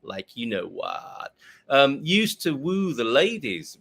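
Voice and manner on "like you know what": put-on slow, low American Valley Girl fry; American vocal fry. Shown as a demonstration. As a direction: creak it out in a put-on American accent. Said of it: "with the vocal".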